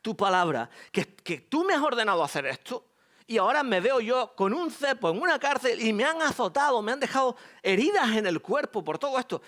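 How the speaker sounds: complaining to God in a mock-angry rant; angry complaint. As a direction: rant at God about the injustice. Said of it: "estaría enfadado básicamente con Dios".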